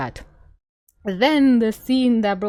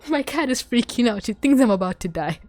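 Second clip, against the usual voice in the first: usual speaking voice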